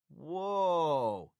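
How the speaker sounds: long, loud, high Texas "whoa"; Texas drawl. A narrator's reading. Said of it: "the man spoke in his normal Texas drawl".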